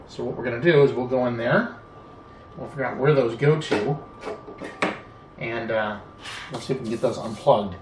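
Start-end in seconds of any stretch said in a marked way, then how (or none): none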